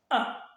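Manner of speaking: "A" cut very short; brief, abrupt "A"; fourth tone. Shown as a demonstration. Teacher models the brief fourth tone, "A" cut short. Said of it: "il est grave et bref".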